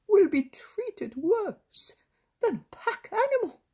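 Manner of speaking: put-on an old man's pleading climbing toward despair; anguished grandfather. A narrator's reading. Grandfather fearing for his grandson, rising anguish.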